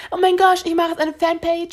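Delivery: high, gushing over-the-top fan; giddy fangirl. Retold or imitated. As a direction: squeal it like an obsessed fan. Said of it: "aber ich bin nicht so".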